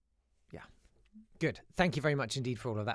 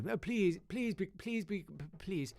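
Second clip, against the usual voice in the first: a lightly voiced, higher begging teacher; a helpless supply teacher begging the class; pleading teacher; 7 semitones higher, slower than usual. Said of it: "just out of the blue".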